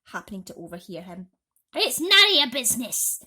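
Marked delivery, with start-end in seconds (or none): 1.7-3.2 angry scolding aunt. A narrator's reading.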